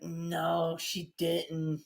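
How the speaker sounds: low, soft mock shock; hushed disbelief. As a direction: drop low, as if scandalised. Josh's imagined disbelieving reaction.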